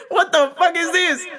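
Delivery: high, alarmed retold reaction with a laugh in it; mock panic. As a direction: act out comic alarm. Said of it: "I panicked".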